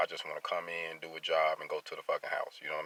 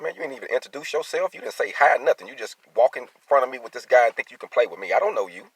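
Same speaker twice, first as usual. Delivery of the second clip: much higher and louder, reliving the argument; retold confrontation; 8 semitones higher, 9 dB louder than usual. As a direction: tell him off again, loud and indignant.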